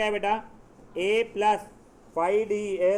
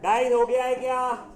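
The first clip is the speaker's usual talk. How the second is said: raised, louder teacher's call; calling to class; 4 semitones higher, 6 dB louder than usual. Real, not acted. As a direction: call out to the whole room, pitched higher.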